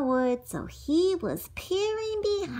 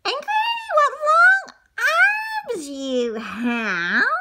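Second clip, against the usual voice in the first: put-on high amazed girl; 4 dB louder than usual. Girl staring at granny's eyes, high and amazed. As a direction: marvel up high like a small girl.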